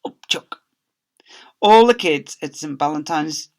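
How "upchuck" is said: disgusted whisper; whispered upchuck. A narrator's reading. Disgust aside.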